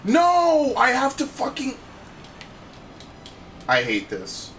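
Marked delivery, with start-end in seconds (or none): 0.0-1.8 high, loud frustrated cry; frustrated yell. Real, not acted. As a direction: shout it out in dismay. Frustrated gaming outburst.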